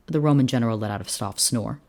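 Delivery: plain reading voice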